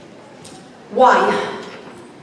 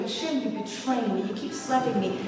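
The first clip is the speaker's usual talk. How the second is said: much softer, a low wounded murmur; hurt and betrayed; 6 semitones lower, 11 dB quieter than usual. Retold or imitated. Feeling betrayed by Hashem over her suffering, soft wounded murmur. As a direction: let the hurt sink quietly into the words. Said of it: "I feel betrayed".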